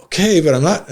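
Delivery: high, pitiful sick voice; sickly whine. Retold or imitated. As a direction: whimper you're not fine. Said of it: "I'm like".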